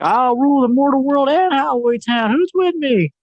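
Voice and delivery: hammed-up evil mayor boast; the mayor proclaiming his rule to the crowd, hammed-up; villain proclamation.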